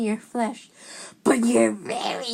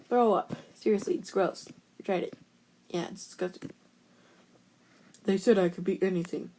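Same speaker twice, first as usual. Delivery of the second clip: ordinary talk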